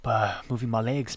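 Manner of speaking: ordinary talk, nothing marked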